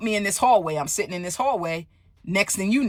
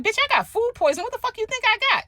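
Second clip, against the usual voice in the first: high, fast, exasperated mock retort; incredulous sass; 13 semitones higher, faster than usual. Patient sassing the orderly who says she'll be okay, exasperated. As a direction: throw it back at him in disbelief.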